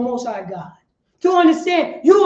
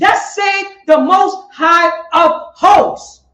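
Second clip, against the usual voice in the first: slow, weighty preacher reading; slow emphatic demo; 5 dB louder, much slower than usual. Shown as a demonstration. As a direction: draw out each word with weight. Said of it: "Say it again".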